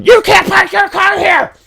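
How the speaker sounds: high-pitched yelling imitation of an angry carrier. Mail carrier yelling at buddy over parking, high yell. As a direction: screech it out like a scolding shout. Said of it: "so she can yell at".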